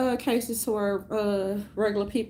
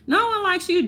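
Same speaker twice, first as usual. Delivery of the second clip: No pitched much higher and louder, like a playground jab; louder taunt; 10 semitones higher, 5 dB louder than usual. Retold or imitated. Mimicking critics, jeering No louder at the comic. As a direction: jeer the word No up high. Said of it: "so they can feel like".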